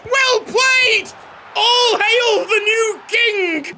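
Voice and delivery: high, slow grand proclaim; mock royal proclamation. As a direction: herald hailing a king.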